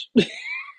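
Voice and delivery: high shrieking laugh bursts out. Real, not acted. Laughs at own joke.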